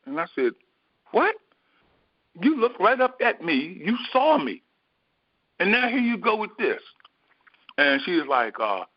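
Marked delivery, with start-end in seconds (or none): 1.1-6.8 angry protest. Retold or imitated. Jogger confronting the mail carrier, exasperated and indignant. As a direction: throw her own words back at her, incredulous. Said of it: "I was angry".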